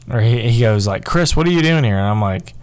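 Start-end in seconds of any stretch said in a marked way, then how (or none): 1.0-2.0 pitched up and louder, retold challenge; surprised coach. Coach confronting a cut player at practice, raised surprise. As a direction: imitate a coach's startled question. Coach's question, name corrected.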